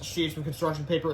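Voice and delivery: plain reading voice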